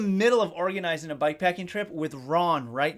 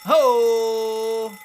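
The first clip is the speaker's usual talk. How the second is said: loud hoot; 5 semitones higher, 9 dB louder than usual. Real, not acted. Reacts to a sound in chat.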